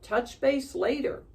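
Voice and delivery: put-on girl calling out as she leaves the field; yelled. A narrator's reading. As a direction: call it back while running away. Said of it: "she yelled".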